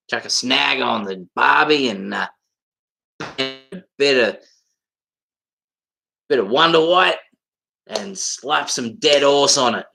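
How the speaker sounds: broad, hammed-up Aussie slang delivery. Shown as a demonstration. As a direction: lay on the barbie-talk Aussie accent. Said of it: "how Aussie was that for you".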